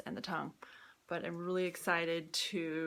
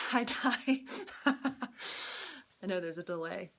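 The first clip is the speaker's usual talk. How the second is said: laughing greeting; much slower than usual. Real, not acted. Greets watching friend, laughing.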